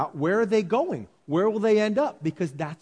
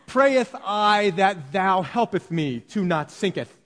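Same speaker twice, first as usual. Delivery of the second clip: slow, hammed-up King James prayer voice, louder; mock old-fashioned prayer; 4 semitones higher, 5 dB louder, slower than usual. Preacher mocking a pompous prayer to God, slow and hammed-up. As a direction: overdo the pompous thee-and-thou prayer. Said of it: "He doesn't say".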